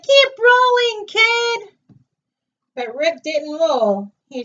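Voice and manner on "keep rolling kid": high, loud sing-song yell; cheerful boulder yelling encouragement. A narrator's reading. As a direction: shout it downhill after him.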